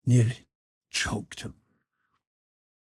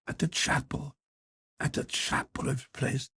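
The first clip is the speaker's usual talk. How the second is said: soft mocking mimicry of the boy's flat Dublin accent, half to himself; mimicking flat accent; 3 semitones higher, 6 dB quieter than usual. Drunk father mocks his son's flat Dublin accent, muttered soft. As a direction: sneer the boy's flat Dublin accent under your breath. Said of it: "He began to mimic his son's flat accent, say half to himself".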